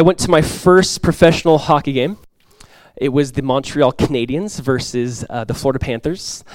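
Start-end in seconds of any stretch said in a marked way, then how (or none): none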